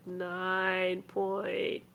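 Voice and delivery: very slow, stretched-out scoring; drawn-out deliberation. Real, not acted. Co-host scoring dull special features, drawn-out deliberation. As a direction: drag out each word, ending on a laugh. Mock deliberation over a score.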